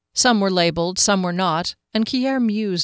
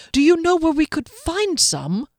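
higher, pleading barely held in check; badly hidden desperation; 10 semitones higher, 3 dB louder than usual. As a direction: ask as if calm but can't quite hide it. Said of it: "masking his own desperation unsuccessfully".

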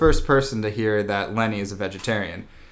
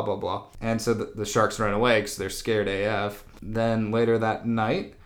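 ordinary talk, nothing marked

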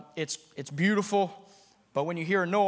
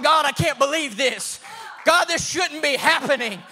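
tearful prayer; 8 semitones higher, 5 dB louder, slower than usual. Retold or imitated. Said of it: "tears pouring down my face".

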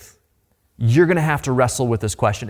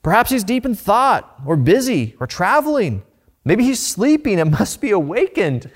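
raised, teasing sarcasm; mocking taunt; 7 semitones higher than usual. Preacher voicing Elijah mocking the sleeping god, lightly raised sarcasm. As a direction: needle them with mock concern. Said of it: "he said".

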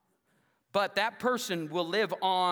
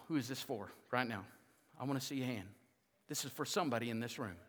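quiet, lower personal call; softer, lower appeal; 5 semitones lower, 8 dB quieter, faster than usual. Acted. Pastor quietly asking the room who needs this, soft and low. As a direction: lean in and ask gently.

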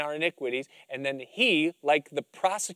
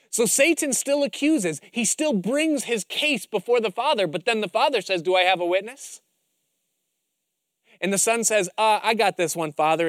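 rising preaching; 5 semitones higher, 5 dB louder than usual. Acted. Preaching climax, Son answers.

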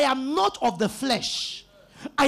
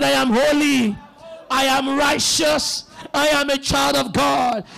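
louder, emphatic preacher's confession; loud declaration; 4 dB louder than usual. Shown as a demonstration. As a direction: proclaim it loudly for the congregation to repeat. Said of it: "Say it loud again".

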